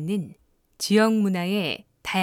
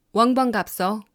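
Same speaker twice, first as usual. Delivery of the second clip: louder, clipped Jeju accent; Jeju dialect; 4 dB louder than usual. Shown as a demonstration. Narrator demonstrating the hard-to-follow Jeju dialect, clipped Jeju accent. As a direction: snap the ending off in Jeju dialect. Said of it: "말끝을 짧게 자른 것 같은".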